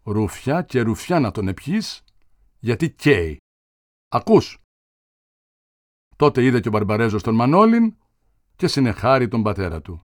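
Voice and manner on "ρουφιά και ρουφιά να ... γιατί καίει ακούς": plain reading voice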